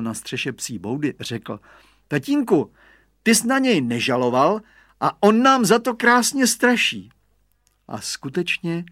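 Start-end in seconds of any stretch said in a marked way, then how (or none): 2.1-7.1 put-on high child's voice; little boy. A narrator's reading. Radovan speaks up to his father from the roof, boyish. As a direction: pipe up like a small son.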